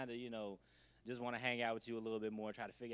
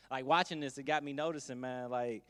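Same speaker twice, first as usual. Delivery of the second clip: ordinary talk, nothing marked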